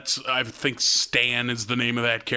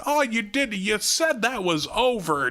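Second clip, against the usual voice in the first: Gil on the phone; 10 semitones higher than usual. Retold or imitated. Gil's wife, Gil replying.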